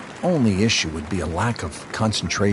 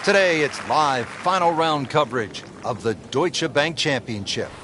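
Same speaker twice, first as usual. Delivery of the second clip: announcer voice; 4 semitones higher than usual. Acted. Broadcast intro announcement.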